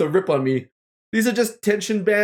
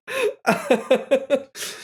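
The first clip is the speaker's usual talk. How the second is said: strained giggle; nervous laugh; 6 semitones higher than usual. Real, not acted. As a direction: giggle through the effort. Nervous laugh while stretching.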